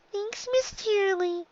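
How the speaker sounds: put-on high, slow small child's voice; a shy young filly, high and unhurried; little filly voice. Dicky thanks teacher.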